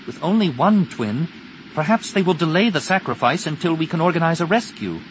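high-pitched character voice; Tenel Ka's voice. Acted.

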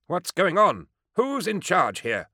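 high-pitched, indignant demand. A narrator's reading. Doctor outside demands to know who is in charge, indignant. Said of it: "demanded a voice from outside".

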